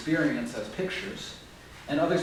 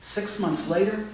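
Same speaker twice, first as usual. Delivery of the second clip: usual speaking voice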